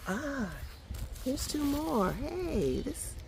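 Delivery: high-pitched, pleased discovery; delighted surprise. Real, not acted. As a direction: exclaim high at a happy find.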